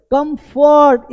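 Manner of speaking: कंफर्ट with full "FORT", high and slow; wrong pronunciation. Shown as a demonstration. Teacher shows what not to say, overpronounced. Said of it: "ये फर्ट नहीं बोलना है".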